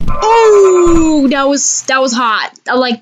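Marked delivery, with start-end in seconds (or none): 0.1-1.3 loud high burst of excitement; excited shout. Real, not acted. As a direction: whoop it out, thrilled. Excited at a trick.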